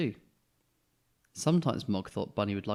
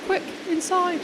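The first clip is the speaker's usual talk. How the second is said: much higher, loud urgent call; shouted urgently; 7 dB louder than usual. Acted. Mum shouting the family indoors from thunder, urgent. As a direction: hurry everyone in from the storm. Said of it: "shouted Missus Thomas".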